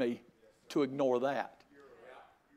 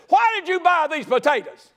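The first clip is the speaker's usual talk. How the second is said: high, loud exasperated shout; retold blowup at his wife over potatoes; mock angry yell; 11 semitones higher, 9 dB louder than usual. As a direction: bark the question at the wife.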